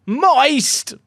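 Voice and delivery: high, loud shout of Moist. Real, not acted. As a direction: bellow Moist at the top of your voice. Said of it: "shout moist at the top of my voice".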